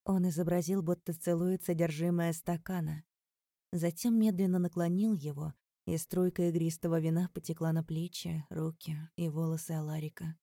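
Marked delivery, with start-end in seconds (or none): none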